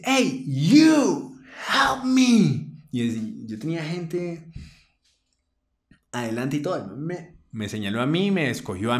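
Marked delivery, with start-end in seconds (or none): 0.0-2.7 high loud call for help. Retold or imitated. Friend summoning the narrator, loud and high. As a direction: call him over loudly, pitched high. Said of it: "me dice".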